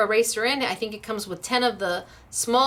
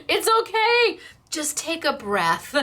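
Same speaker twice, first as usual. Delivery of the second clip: laughing reassurance; 7 semitones higher, slower than usual. Real, not acted. Laughing while reassuring viewer.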